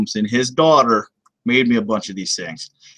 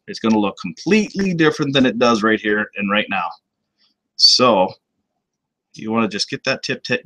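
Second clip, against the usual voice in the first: ordinary talk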